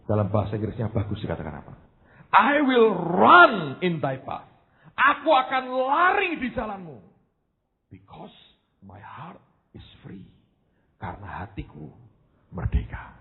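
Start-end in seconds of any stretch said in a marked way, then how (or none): 2.3-7.0 slow, high, solemn recitation; intoned Bible verse; scripture reading. Acted. 7.9-13.2 very soft, slow, nearly whispered; softly breathed scripture; hushed. Real, not acted.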